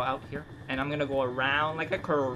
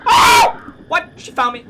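high, loud panicked shriek; terrified scream; 19 dB louder, slower than usual. Real, not acted. Gamer found by the monster, screaming in fright. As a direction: scream as she finds you.

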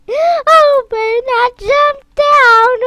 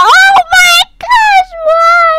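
higher, louder, slow cry of "why"; mock despair; 10 semitones higher, 8 dB louder, slower than usual. Retelling her own death in game, hammed-up wail. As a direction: wail it like it's unfair. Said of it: "I'm like".

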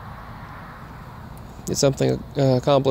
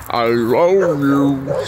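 slow, drawn-out dog voice saying I love you; lightly voiced dog, very slow; mock dog saying I love you; much slower than usual. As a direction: stretch it out like a dog trying to talk. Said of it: "The brown dog said".

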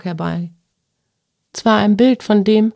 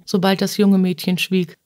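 ordinary delivery, nothing marked

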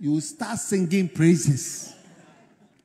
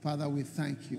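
leading prayer; 4 semitones lower, 8 dB quieter than usual. Real, not acted. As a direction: usher the congregation gently into prayer. Leads congregation into prayer.

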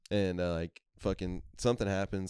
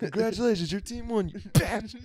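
much higher sneering taunt; mock taunt; 13 semitones higher, 3 dB louder than usual. Boys pelting Braves fans with quarters, high sneering jeer. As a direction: jeer at the winners.

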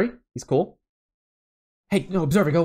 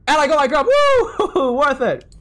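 high, loud victorious whoop; gleeful, then laughing; triumphant cheer; 11 semitones higher, 6 dB louder, slower than usual. Real, not acted. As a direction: celebrate the win. Triumph at getting grub.

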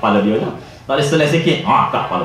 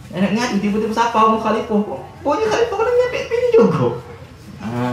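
laughing aloud; 8 semitones higher than usual. Real, not acted. Laughing at the punchline.

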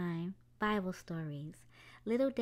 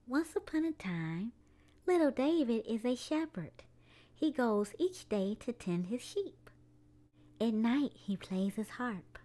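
gentle storybook narration for little ones; children's story time read-aloud. A narrator's reading. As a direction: tell it like a bedtime story. Reads a picture book aloud.

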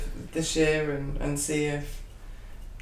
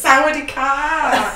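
high, loud, drawn-out Thai greeting; super animated; 10 semitones higher, 6 dB louder, slower than usual. Retold or imitated. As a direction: imitate an over-excited greeter, stretching it out. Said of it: "super animated and said it as".

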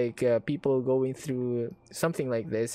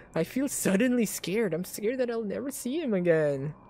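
ordinary talk

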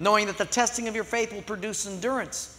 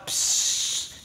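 air hiss. Retold or imitated.

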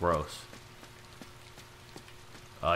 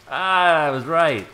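excited; 8 semitones higher, 11 dB louder, slower than usual. Real, not acted. Triumphant after jump.

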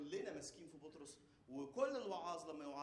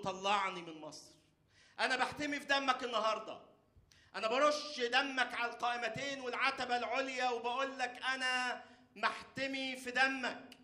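fervent prayer; 10 semitones higher, 10 dB louder than usual. Acted. Preacher crying out prayer for the congregation, loud.

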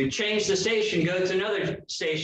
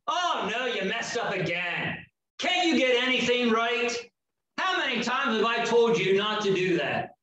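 sharp, scolding nag, pitch jumping higher; accusing voice; 6 semitones higher than usual. Retold or imitated. Preacher mimicking an accusing inner voice, harsh scolding. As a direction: scold like a disappointed critic. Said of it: "not an accusing voice".

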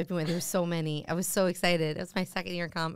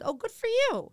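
much higher, sing-song "good for you"; mock encouragement. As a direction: humour him with fake cheer. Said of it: "And I said".

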